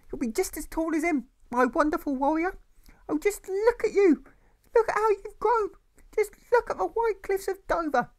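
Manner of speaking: a tipsy mother gushing over her son; slurring mother. Acted. Mother gushing at her son instead of answering, slurred.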